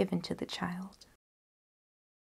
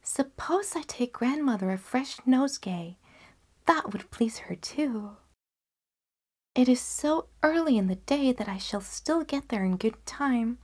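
higher, lightly voiced little girl; girl's thought; 5 semitones higher than usual. Girl deciding to pick flowers, higher. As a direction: muse brightly to herself, higher. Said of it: "she thought".

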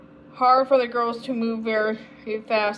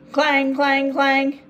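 loud, ringing clangs; clanging bell imitation; 3 semitones higher, 5 dB louder, much slower than usual. A narrator's reading. Streetcar startling Samantha, loud imitated clanging. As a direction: sound the alarm like a streetcar bell.

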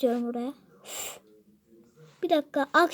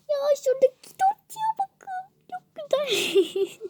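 much higher, squeaky gushing; squealing delight; 10 semitones higher than usual. Pretend. Gamer showing viewers the cake, high squealing delight. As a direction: squeal over the cake like it's adorable.

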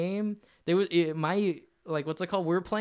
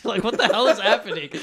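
laughing; 3 semitones higher, 8 dB louder than usual. Real, not acted. Laughing at the absurd moment.

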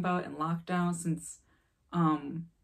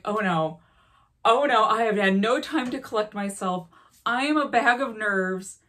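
louder, higher panicked inner voice; retold panic; 4 semitones higher, 8 dB louder than usual. Breathless from the station, she panics to herself. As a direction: replay the rising panic, slowing on the last line. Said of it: "I'm like".

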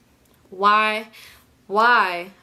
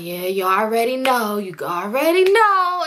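drawn-out, rising mock brag; hyped mock boast; 3 dB louder, slower than usual. Man bragging to the people who greet him, hyped mock boast. Acting out man's boastful reply to bystanders.

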